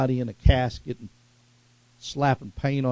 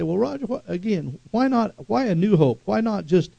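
higher-pitched puzzled objector; questioning listener; 5 semitones higher than usual. Acted. Listener questions the preacher about new hope, higher puzzled voice. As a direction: voice a doubter pressing with questions. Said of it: "now you might say".

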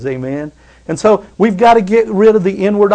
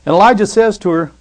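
normal talking voice

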